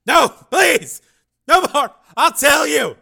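high, loud cries for mercy; terrified pleading. Acted. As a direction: beg desperately at the top of your voice. Tortured dragon begs for mercy.

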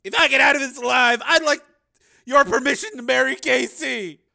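high, loud shout over the din; yelled, high-pitched earnest request; shouted plea. Acted. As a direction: call it out at full voice. Shouted request to marry while in danger.

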